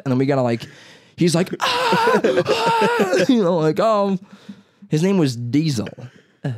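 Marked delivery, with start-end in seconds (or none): 1.6-3.2 very high, loud comic wail; imitated dog yelp. Imitating a dog with a broken tail, mock yelping. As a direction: cry out like a hurt dog, half laughing.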